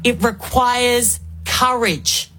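usual speaking voice

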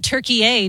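Turkey A said as TUR-key-AY; teasing mispronunciation. Pretend. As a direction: tack a long AY onto Turkey A. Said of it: "I bet you call it".

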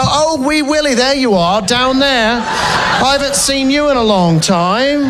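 mock tender English self-talk, laughing; mock English accent. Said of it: "does he look in the mirror every morning and go".

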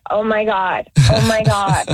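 normal talking voice

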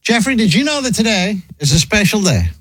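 ordinary talk

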